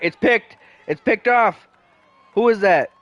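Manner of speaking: high, loud play-by-play shout; excited call. Real, not acted. Interception called excitedly.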